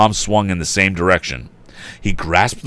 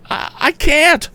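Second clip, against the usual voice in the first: very high, stammering fear; frightened; slower than usual. Acted. Young lady answering Tom in panic, high frightened stammer. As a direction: falter out in panic. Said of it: "she answered frightenedly".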